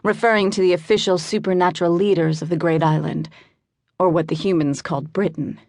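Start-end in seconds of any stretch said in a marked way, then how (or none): none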